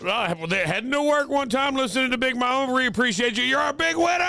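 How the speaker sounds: high, hyped radio host; excited winner announcement. Real, not acted. DJ telling a caller she's our big winner, excited announcement. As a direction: build to a big high shout.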